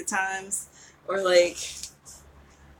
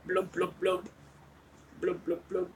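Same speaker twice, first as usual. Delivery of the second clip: underwater blub noises; 7 dB quieter than usual. Pretend. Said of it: "now I'm underwater".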